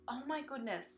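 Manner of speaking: ordinary talk, nothing marked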